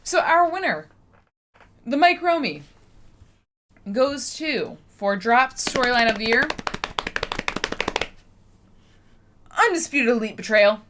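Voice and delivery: high, loud award reveal; award announcer. Acted. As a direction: announce the winner with pomp.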